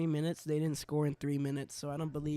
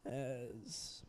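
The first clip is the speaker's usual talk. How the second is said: live hockey play-by-play; play-by-play commentary. Acted. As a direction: call the game as it happens.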